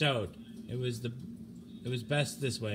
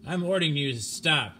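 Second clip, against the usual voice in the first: louder, firm order; loud command; 3 semitones higher, 7 dB louder than usual. Acted. The captain ordering Applegate to stop, loud firm command. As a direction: make the order stick.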